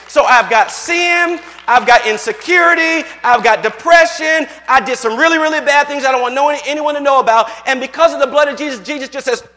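impassioned preacher building his point; full-force preaching. Real, not acted. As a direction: drive it home to the congregation, loudly.

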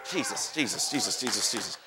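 very low, hushed repetition; murmured invocation. Real, not acted. Murmured aside between preached lines.

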